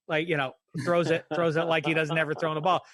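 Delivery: ordinary talk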